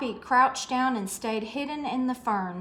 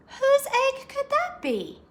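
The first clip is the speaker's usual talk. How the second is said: high, wondering rabbit voice; small puzzled creature wondering aloud; 14 semitones higher than usual. Acted. Rabbits puzzled, asked in character.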